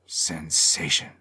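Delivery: plain reading voice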